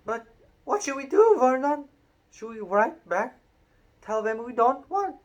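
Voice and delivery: high, loud anxious woman; panicky wife. A narrator's reading. Aunt Petunia frets to Vernon about what to do, high and loud. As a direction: fret in a high voice.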